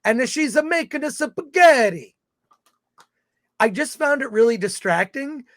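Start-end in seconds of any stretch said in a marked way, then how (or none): 0.0-2.2 hammed-up Italian accent, higher; a jokey Italian accent, mama in the kitchen; mock Italian accent. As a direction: mimic a thick Italian accent for laughs. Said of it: "with a very thick Italian accent".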